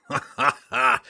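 loud, scornful villain laugh; mocking laughter. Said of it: "Roddy's mocking laughter".

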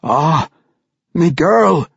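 put-on an old dwarf overwhelmed at the reunion; emotional dwarf. A narrator's reading. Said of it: "he said".

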